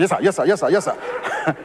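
lightly voiced driver, just humoring the boss; driver's offhand yes sir. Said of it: "driver voiced in story".

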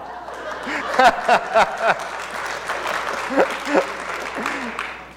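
a burst of crowd laughter. Real, not acted. Audience laughs after punchline.

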